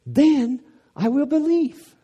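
very high, slow and loud; mock skeptic ultimatum. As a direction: push the demand up to a peak. Mock skeptic, even higher.

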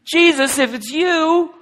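high, loud whiny plea; mock Peter pleading. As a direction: beg him to hurry into the boat. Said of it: "Why not".

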